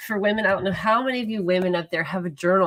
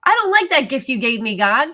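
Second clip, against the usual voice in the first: complaining prayer; 4 semitones higher, 8 dB louder than usual. Retold or imitated. Acting out her complaining prayer.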